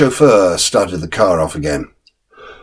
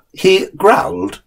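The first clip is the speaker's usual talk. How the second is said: higher, slow disapproving nurse's voice; sharp nurse; 10 semitones higher, slower than usual. Acted. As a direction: correct the boy primly. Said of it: "said the nurse".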